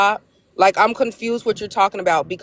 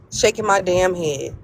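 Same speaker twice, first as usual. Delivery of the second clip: ordinary talk, nothing marked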